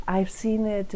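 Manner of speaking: usual speaking voice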